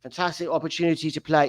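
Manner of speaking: hammed-up British footballer accent; generic British accent. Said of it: "I'm just going with generic Brit".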